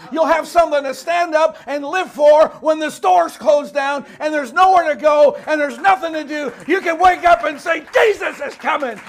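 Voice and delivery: loud, high revival-preacher shout; full-force preaching. Real, not acted. Preacher rallying the congregation with hope of Jesus' return, shouted. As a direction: drive each phrase home at full volume.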